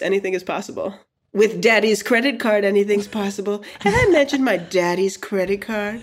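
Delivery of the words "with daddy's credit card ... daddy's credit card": put-on spoiled preppy girl, a bit higher; snooty rich girl. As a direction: brag like a spoiled daddy's girl. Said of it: "It's fake, and this is a character".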